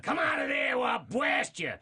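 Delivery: high, loud, shouting Fudd rage; Elmer snapping. Acted. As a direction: snap into a wild yell. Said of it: "he would just snap".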